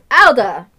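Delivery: loud call across the room. Acted. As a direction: hail her loudly by name. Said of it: "he says".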